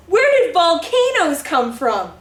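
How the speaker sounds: usual speaking voice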